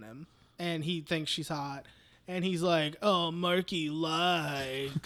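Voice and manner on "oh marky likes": put-on slow, drawn-out leering voice of a dude checking a girl out; mock creepy Mark. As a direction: stretch it out like a creep sizing her up. Mocking Mark ogling girl.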